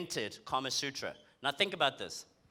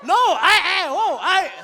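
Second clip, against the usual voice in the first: much higher and louder startled stammer; flustered; 11 semitones higher, 12 dB louder, slower than usual. Real, not acted. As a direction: caught off guard, stammering. Flustered reaction to audience.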